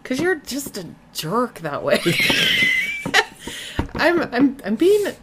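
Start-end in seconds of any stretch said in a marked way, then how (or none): 2.0-3.9 long, very high-pitched burst of laughter; real squealing laugh. Real, not acted. Laughing after teasing partner.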